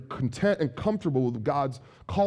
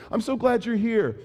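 fake cheerful; 8 semitones higher than usual. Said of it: "You are so livid".

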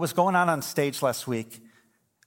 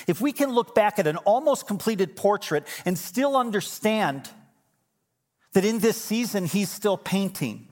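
rising, louder preaching; building sermon climax; 9 semitones higher, 3 dB louder than usual. Acted. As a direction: drive the sermon to its peak. Preaching at full force.

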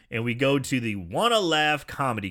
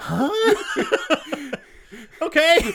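usual speaking voice

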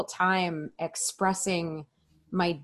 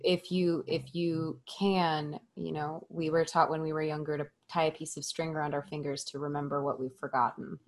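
tender vow; 2 semitones lower, 10 dB quieter than usual. Retold or imitated. Woman retelling her proposal to her partner, hushed and tender.

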